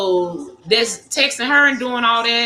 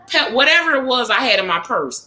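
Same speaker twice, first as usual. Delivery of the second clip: ordinary delivery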